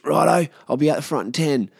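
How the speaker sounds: acted chipper readiness; eager mate. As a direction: fire back as the raring-to-go mate. Mate raring to go on phone.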